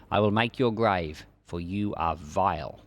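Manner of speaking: normal talking voice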